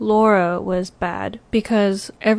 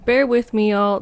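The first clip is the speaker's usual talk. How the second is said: usual speaking voice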